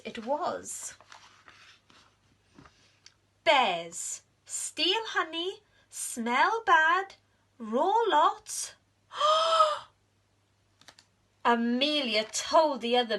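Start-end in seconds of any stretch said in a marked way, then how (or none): 3.5-8.7 high, slow reading aloud; reading clues. Acted. Amelia reading bear facts. 9.1-9.8 sharp high gasp of discovery. Acted. Amelia realizes Norman is bear.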